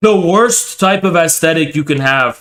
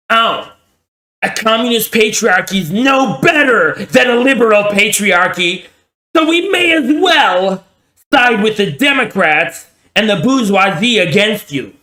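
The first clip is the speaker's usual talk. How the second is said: high, mocking whiny voice; mocking liberal feminist; 5 semitones higher than usual. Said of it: "they're gonna be like".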